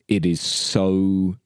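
usual speaking voice

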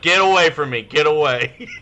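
loud, high get-away yell. Retold or imitated. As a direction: shove them off with your voice. Retold yelling at coworker.

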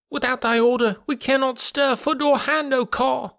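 very high, thin chanting monkey voices; spellbound monkeys. A narrator's reading. Monkeys obeying the python, high entranced chant. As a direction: answer as a high-pitched entranced chorus.

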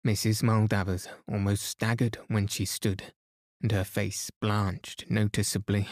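usual speaking voice

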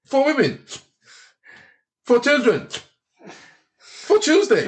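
high-pitched mock toastmaster; imitated promoter's toast. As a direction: toast each one separately, pitched well up.

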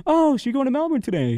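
high, quick, chirpy question; imitated check-in lady. Lady greeting traveler at the kiosk, high and quick. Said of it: "this lady comes by. She's like".

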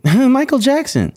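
high, slow, drawn-out [name]. Retold or imitated. [name] naming himself on the phone, high and slow. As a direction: float the name up high and slow.

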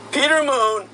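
usual speaking voice

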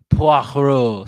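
Poirot said loud with a French rasp; exaggerated French accent. Shown as a demonstration. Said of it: "Okay, I'm gonna try".

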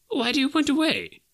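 very high, agitated question; exclaiming. Acted. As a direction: cry out to the spirit in alarm. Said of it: "Scrooge exclaimed".

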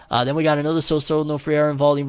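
normal talking voice

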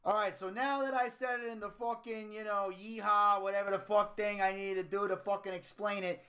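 lightly voiced mobster Don; Tony D. The Don announces his battle royal, brash. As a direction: play a brash Italian-American Don.

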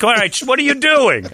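hammed-up shocked parent demanding answers; outraged parent. Parent confronting the coach.

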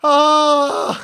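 high puffing groans; mock out of breath. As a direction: imitate a winded mum gasping into the phone. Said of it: "she rings me like this".

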